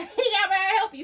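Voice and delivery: high, loud laughing greeting. Real, not acted. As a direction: laugh while mimicking your polite reply. Retelling greeting the man, laughing.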